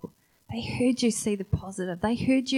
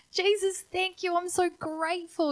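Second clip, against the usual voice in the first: high, breathless thanks; grateful joy; 9 semitones higher than usual. Retold or imitated. Healed man thanks Jesus, high grateful voice. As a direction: pour out thanks, still high. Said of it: "He's like".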